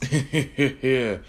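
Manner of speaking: short evil laugh; villain cackle. Acted. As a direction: cackle at the scared girls.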